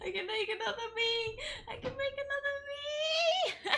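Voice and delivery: very high, laughing excitement; giddy squeal. Real, not acted. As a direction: squeal through giggles, easing off in volume after the first burst. Thrilled she can make herself.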